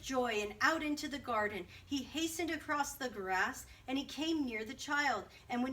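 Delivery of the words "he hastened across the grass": plain reading voice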